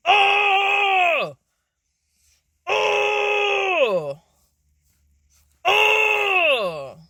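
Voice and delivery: drawn-out high shouted oh; loud anguished cry. Real, not acted. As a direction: cry out as if struck to the heart. Struck by the Lord's words.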